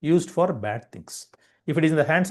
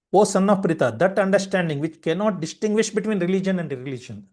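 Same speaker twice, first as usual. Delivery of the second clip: raised, measured verse recitation; reading scripture; 4 semitones higher than usual. Acted. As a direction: intone the translation like holy text. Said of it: "I'll just read the translation".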